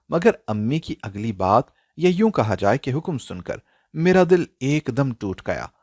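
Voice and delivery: usual speaking voice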